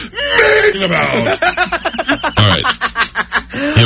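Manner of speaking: high, hammed-up drunk voice; mock drunk, high and giggly; drunk impression. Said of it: "here's my impression of a, uh, who drinks too much".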